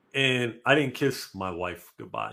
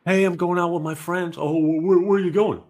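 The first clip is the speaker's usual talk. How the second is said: mock girlfriend; 6 semitones higher, 4 dB louder than usual. Acting out a couple's exchange.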